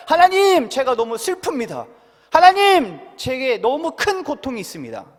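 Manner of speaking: loud, high anguished cry of God's name. Shown as a demonstration. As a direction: call out to God in pain. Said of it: "소리내서 외쳐야 됩니다".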